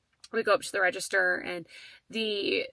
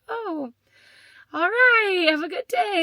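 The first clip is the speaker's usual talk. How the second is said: high, louder, forced cheerful goodbye; awkward brush-off; 10 semitones higher, 6 dB louder, slower than usual. Retold or imitated. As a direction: end the talk with a stunned, bright farewell. Shocked brush-off leaving the store.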